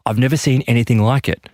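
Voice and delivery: ordinary delivery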